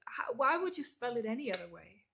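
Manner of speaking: ordinary talk, nothing marked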